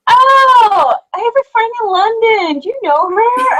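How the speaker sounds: high-pitched imitation of gushing Americans; mock American accent. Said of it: "that sounded very American".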